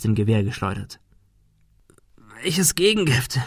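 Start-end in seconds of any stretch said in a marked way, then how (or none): 2.4-3.4 higher, strained question; in pain. A narrator's reading. Wounded Thomas asking about the antidote, in pain. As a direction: ask it through pain. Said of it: "fragte er unter Schmerzen".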